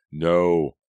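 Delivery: loud, drawn-out cry of NO; long anguished shout; howled. A narrator's reading. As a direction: howl it out in grief. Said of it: "tossed back his head and howled".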